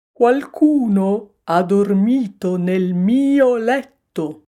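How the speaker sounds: slow, loud, put-on bear grumble; papa bear grumbling. A narrator's reading. Papa bear finding his bed slept in, grumbling. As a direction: grumble loudly and slowly as the big bear. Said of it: "brontolò papà orso".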